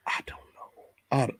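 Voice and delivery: a breathy, voiceless whisper of I don't know. Real, not acted. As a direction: whisper it under your breath, giving up. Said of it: "whispered aside".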